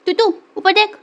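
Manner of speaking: high, loud call to the cat. Real, not acted. Calling the cat to look up. (बिल्ली को ऊँची, तेज़ आवाज़ में पुकारना)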